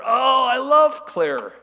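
very high, very loud, drawn-out rapture; hammed-up, swooning enthusiasm; over-the-top gushing. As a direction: imitate boundless affection at full volume. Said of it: "Isaiah is just so welcoming".

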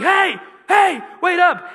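shouted high and loud across the terminal; calling out. Retold or imitated. Chasing the cop through the airport, shouting high and loud. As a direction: yell to stop someone walking away.

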